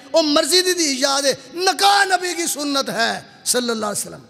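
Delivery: heated preaching. Real, not acted. Preacher mocking selective memory of hadith, heated and high. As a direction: drive the reproach home from the pulpit.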